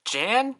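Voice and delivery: "Jan" as a high, rising question; alternative pronunciation. Shown as a demonstration. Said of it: "other pronunciations".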